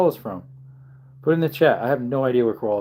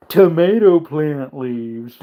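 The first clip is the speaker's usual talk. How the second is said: silly voice; 3 semitones higher, 5 dB louder, much slower than usual. Retold or imitated. Mock answer voice.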